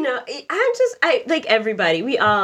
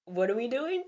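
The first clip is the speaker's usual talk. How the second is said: quiet; 10 dB quieter than usual. Retold or imitated. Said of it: "My brain was just like".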